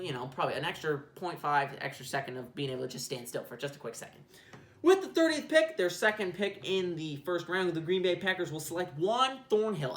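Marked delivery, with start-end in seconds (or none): 4.8-10.0 higher, slow announcer read of the pick; mock commissioner announcing the selection; draft announcer.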